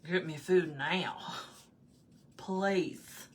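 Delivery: slow, drawn-out Southern drawl; Southern woman. Retold or imitated. Voicing the cat wanting food, slow Southern drawl. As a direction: demand it as a drawling Southern lady. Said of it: "Maggie's like a Southern woman".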